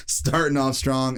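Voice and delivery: laughing. Real, not acted. Laughing repeat of phrase.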